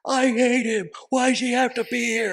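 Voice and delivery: very high, loud mock-whiny outburst; daughter's tantrum. Daughter protesting about him being there, mock tantrum. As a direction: imitate a girl throwing a fit. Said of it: "Sounds like Jenny".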